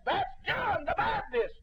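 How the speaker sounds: high, loud, slow shout; Herod's alarmed cry. Retold or imitated. King Herod crying out on seeing Jesus, shouted. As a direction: cry it out in dread. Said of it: "old King Herod says".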